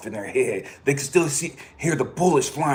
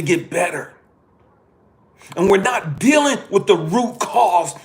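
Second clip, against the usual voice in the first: higher, louder furious rant; angry rant; 8 semitones higher, 7 dB louder than usual. Real, not acted. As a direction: vent the frustration at full voice. Ranting about addiction response.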